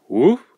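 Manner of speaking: short loud bark; imitated dog bark; dog's woof. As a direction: give one loud woof. Dog replies.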